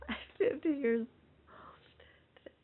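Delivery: slow, high, stunned whisper. Real, not acted. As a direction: breathe it out in disbelief. Stunned at own age.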